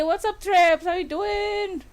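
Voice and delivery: ordinary delivery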